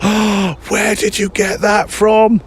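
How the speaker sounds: high-pitched, drawn-out delighted gasp; excited outburst. Retold or imitated. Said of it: "It was a very exciting moment for me".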